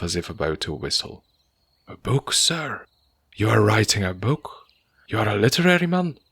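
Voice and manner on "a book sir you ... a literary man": higher and faster with each question; rising awe at meeting a writer; growing astonishment. Acted. Landlord learns his tenant is an author, in astonishment. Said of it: "in progressive astonishment".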